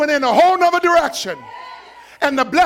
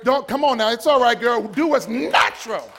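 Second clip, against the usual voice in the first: ordinary talk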